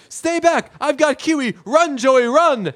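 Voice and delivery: very high, mock-heroic warning cry; panicked Chandler. Friend holding off Ross with a pie, high panic.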